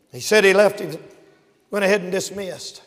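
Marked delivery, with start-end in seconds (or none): none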